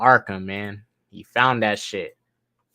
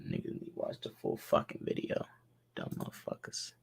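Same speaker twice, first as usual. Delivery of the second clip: much softer whispered grumble; 6 semitones lower, 16 dB quieter than usual. Real, not acted. Streamer grumbling about viewers, whispered under his breath.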